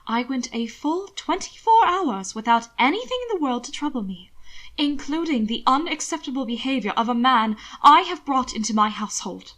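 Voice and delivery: plain reading voice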